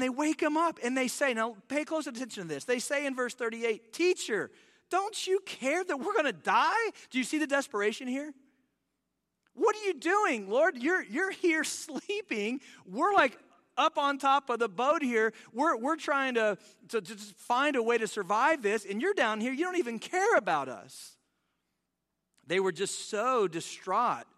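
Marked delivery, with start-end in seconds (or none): 3.9-6.9 desperate plea. Retold or imitated. Disciples cry out in storm. 9.5-21.2 panicked complaint. Retold or imitated. Disciples' panicked complaint to Jesus.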